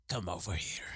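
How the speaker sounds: put-on soft, seductive Spanish accent; low sultry Spanish accent; thick Spanish accent. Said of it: "that thick Spanish accent".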